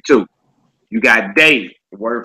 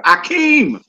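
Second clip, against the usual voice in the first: very high held-out AKEEM, dropping at the end; movie-style name call; 14 semitones higher than usual. Acted. Teacher quoting Coming to America, high drawn-out call. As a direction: sing out "Akeem" like a film quote. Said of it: "that was Eddie Murphy's name on Coming to America".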